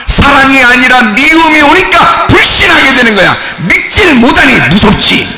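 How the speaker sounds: high, driving shouted sermon; full-force preaching. Real, not acted. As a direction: cry it out to the congregation, pitched high. (높은 목소리로 부르짖는 설교)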